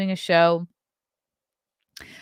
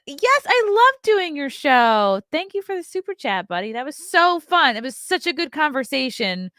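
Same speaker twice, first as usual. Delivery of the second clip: excited; 8 semitones higher, 6 dB louder than usual. Real, not acted. Excited thanks to podcast host.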